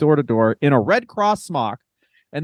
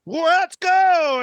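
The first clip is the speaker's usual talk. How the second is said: much higher, pumped-up shout; hyped call; 12 semitones higher than usual. Retold or imitated. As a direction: fire yourself up after the bathroom. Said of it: "he does the fucking".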